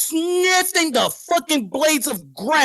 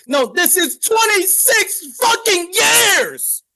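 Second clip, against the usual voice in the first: angry shout; 7 semitones higher, much slower than usual. Real, not acted. Angry rant about own team.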